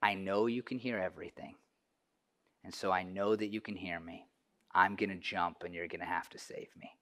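softly voicing the little girl; a hushed, trusting plea at the window; quiet plea. The girl on the ledge calls to the giant, hushed. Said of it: "and she says quietly".

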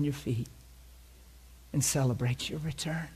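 soft, tender sermon voice; soft preaching. Acted. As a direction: draw them gently into the Father's welcome. Soft tender communion meditation.

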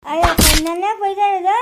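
loud fake sobbing wail; hammed-up crying. Scolded by the wife, he breaks into loud hammed-up crying. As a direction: cry it out like a scolded child, overdone.

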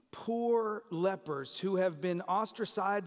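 ordinary talk, nothing marked

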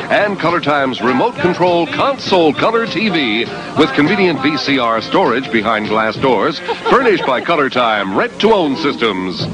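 booming prize announcer. Acted. Announcer reads prize package.

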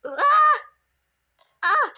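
high loud yelp; panicked shout. Real, not acted. Kid screaming as the game attacks.

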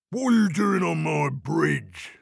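big booming troll; put-on, confrontational troll voice. A narrator's reading. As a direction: challenge the intruder as a storybook troll. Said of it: "said the Troll".

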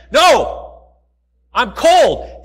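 loud, insistent mock shout; mock outburst. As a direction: blurt it out as the fed-up complainer. Complainer's final outburst.

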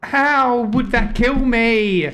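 high, slow exasperated yell; outraged. Real, not acted. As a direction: yell it at the screen. Outraged at death.

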